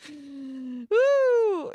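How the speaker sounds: excited whoop. Real, not acted. Excited cheer on stream.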